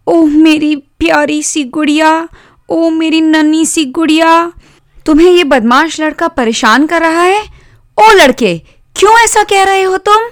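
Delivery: put-on high-pitched girl cooing over her doll, then scolding; sister's high, protective voice; doting little sister. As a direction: coo in a high voice, then challenge the boy.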